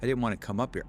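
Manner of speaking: ordinary delivery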